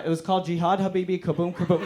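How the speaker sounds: ordinary delivery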